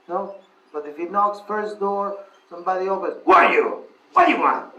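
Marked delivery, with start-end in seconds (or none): 3.2-4.7 loud, high-pitched shout of rejection; angry householder. Acted. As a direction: chase off a beggar at the door. Stranger angrily rejects begging child.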